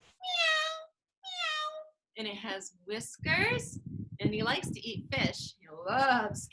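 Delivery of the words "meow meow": very high, soft meowing; squeaky kitten meow; cat meow. Pretend. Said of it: "The next one says".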